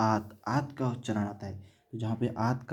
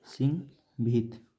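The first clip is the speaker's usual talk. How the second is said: slow dictation; slower than usual. Shown as a demonstration. Teacher repeats example slowly.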